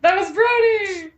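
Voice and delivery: high, loud delighted exclamation; so excited. Real, not acted. Co-host reacting to Brody, high excited outburst. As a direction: burst out in real excitement. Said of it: "I was, like, so excited".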